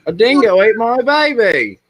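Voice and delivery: laughing, high and loud mock Australian accent; Australian accent impression. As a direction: ham up the Australian line through a laugh.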